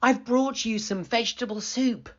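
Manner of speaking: put-on cheerful little girl. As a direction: offer it kindly. Girl's voice.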